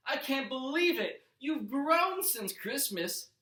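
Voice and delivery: mother amazed her son has grown; delighted mom. A narrator's reading. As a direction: marvel at how big he got.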